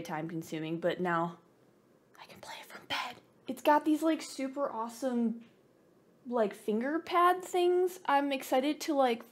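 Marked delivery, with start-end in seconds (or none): 2.2-3.2 soft unvoiced whisper. Real, not acted. Lazy gamer telling viewers she can play from bed, whispered. 6.3-9.2 much higher and slow; excited. Real, not acted. Fan pointing out the trackpads to viewers, high and excited.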